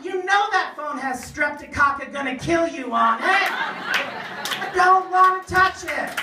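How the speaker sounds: high, loud, slow singsong whine; whiny germ panic. Retold or imitated. Comic telling the guard the phone will kill him, whiny singsong. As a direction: wail it like a germ-terrified kid.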